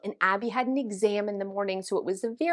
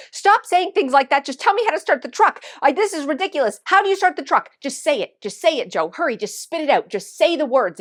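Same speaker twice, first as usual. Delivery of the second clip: high, loud exasperated demands; upset screaming; 4 semitones higher, 8 dB louder than usual. Retold or imitated. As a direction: yell at him to get on with it. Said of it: "now I'm upset and I'm screaming".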